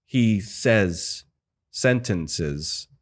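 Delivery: "says" and "sentences" set apart by a pause; word by word. Shown as a demonstration. Mocking the ambassador's line delivery, word by word. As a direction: break it before "sentences", as a halting line read. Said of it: "word by word".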